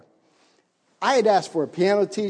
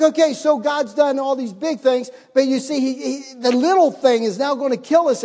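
high, loud anxious rant; frantic; 7 semitones higher, 6 dB louder than usual. Retold or imitated. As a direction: rattle it off in alarm. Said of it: "I'm running around".

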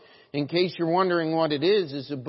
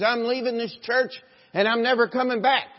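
high, raised-voice declaration; imitated angry argument; 7 semitones higher, 4 dB louder than usual. Church member arguing with his pastor, imitated indignant declaration. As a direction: act out an indignant walkout threat.

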